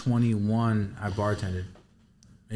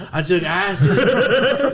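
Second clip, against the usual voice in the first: dazed confession; 7 semitones higher, 5 dB louder, much slower than usual. Retold or imitated. Confessing to mom, retold.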